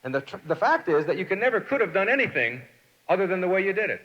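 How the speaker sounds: normal talking voice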